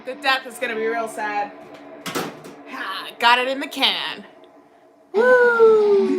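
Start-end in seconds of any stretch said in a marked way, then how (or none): 5.1-6.2 excited cheer. Real, not acted. Cheering at show.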